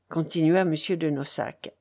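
usual speaking voice

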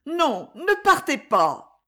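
high, drawn-out plea; imploring, stretched words; pleading cry. A narrator's reading. Exclaimed plea to stay.

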